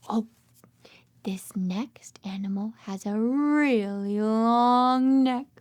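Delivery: ordinary talk, nothing marked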